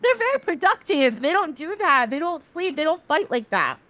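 high, emphatic mock protest; hammed-up disbelief, pitched well up; indignant correction. Sun corrects Moon about how humans act, indignant.

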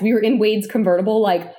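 usual speaking voice

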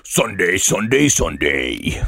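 rising then dropping Sunday, Sunday, Sunday; monster truck ad. Pretend. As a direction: punch each Sunday like an ad. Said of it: "you could do better".

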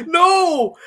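high, loud protest through laughter; cracking up while objecting; laughing. Real, not acted. Protesting the friend's joke, with real laughter.

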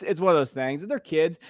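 normal talking voice

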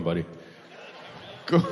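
ordinary talk, nothing marked

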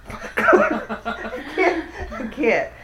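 laughing so hard she can't go on. Real, not acted. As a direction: giggle through the words. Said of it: "losing my mind already".

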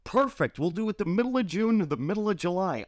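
a hint of a friend's teasing cheer; sarcastic friend. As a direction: overplay the approval sarcastically. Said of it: "It's like".

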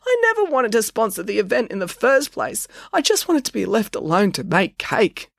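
high, plaintive whine; blubbering. A narrator's reading. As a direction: bemoan it, close to sobbing. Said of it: "blubbered Mister Chelsea".

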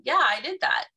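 high, breezy admission; cheerfully owning up. Acted. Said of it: "you agreed and you gave in".

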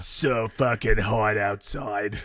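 slow husky-star impression; smoked-out character voice. Co-host slipping into character, slow husky impression. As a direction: drag it out like a smoky star. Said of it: "[name] is slipping into character".

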